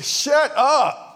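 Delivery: snapping shut up out the window, high; irritated shout at the barking dogs; mock yell. Homeowner yelling out the door at noisy dogs, mock angry.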